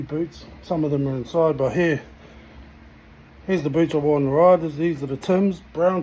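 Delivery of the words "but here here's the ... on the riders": ordinary talk, nothing marked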